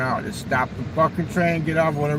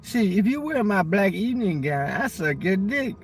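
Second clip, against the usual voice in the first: her voice; 4 semitones higher than usual. Retold or imitated. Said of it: "I said".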